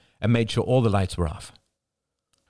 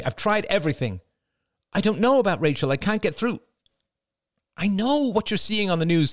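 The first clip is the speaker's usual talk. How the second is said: high-pitched, exasperated phone argument; agitated high voice, trying to get through; frustrated daughter; 12 semitones higher than usual. A narrator's reading. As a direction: plead and protest to an overbearing mother. Daughter arguing with mother on phone.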